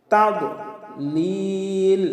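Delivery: "তাদলিল" with a very long held "লিল"; long madd. Shown as a demonstration. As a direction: chant slowly, hold the last vowel of "তাদলিল" three counts. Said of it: "লিলে তিন আলিফ টান হবে". ("তাদলিল"-এর "লিল"-এ তিন আলিফ লম্বা টান)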